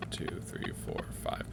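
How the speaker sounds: breathy count to yourself; whispered count. Real, not acted. Player counting to himself in a whisper. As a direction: whisper the numbers under your breath.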